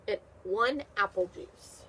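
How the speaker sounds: normal talking voice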